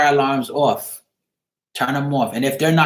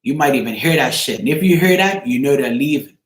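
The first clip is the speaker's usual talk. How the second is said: ordinary talk, nothing marked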